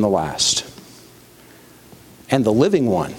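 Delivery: solemn scripture reading. A narrator's reading. Preacher reading Christ's words to John, solemnly.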